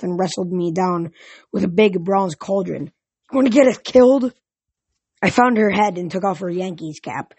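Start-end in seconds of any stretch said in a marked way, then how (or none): 3.3-4.3 raised, higher reproach; exasperated, loud telling-off; scolding. A narrator's reading. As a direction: scold a careless friend urgently. Friend scolds him after his yell.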